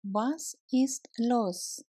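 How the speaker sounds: ordinary delivery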